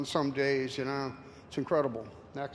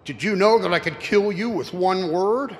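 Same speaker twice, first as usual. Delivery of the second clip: louder menacing devil; Satan threatening; 4 semitones higher, 8 dB louder than usual. Acted. Satan threatens the cowboy, raised menacing voice.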